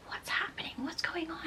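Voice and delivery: groggy whispered question in the dark; barely voiced, confused whisper. Husband wakes, whispers at night.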